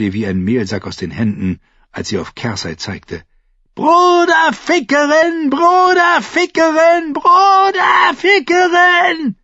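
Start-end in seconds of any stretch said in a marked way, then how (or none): 3.7-9.4 slow, high screamed chant; shrieking. A narrator's reading. Mother pointing at the queen, shrieking in hate. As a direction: scream it over and over, stretching each word. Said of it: "kreischte sie".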